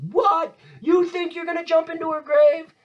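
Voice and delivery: very high, incredulous mock cry; mock outrage. Teacher as Hamlet challenging Laertes at the grave, mock outraged. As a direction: send the pitch way up, hammed-up indignation.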